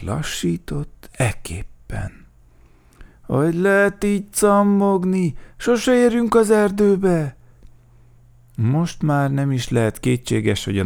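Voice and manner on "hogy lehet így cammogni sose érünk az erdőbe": impatient high-pitched whine, fairly loud; loud, impatient grumble; grumbling. A narrator's reading. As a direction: nag at a slowpoke in a high voice. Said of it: "elég gyakran mirgett, morgott".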